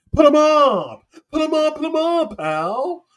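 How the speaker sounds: hammed-up put 'em up; old-timey tough guy. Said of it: "scared of anyone who said the words pal".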